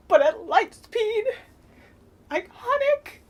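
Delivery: high, loud gushing over the light-speed ram; excited. Real, not acted. Fan gushing to viewers about a film moment, excited squeal.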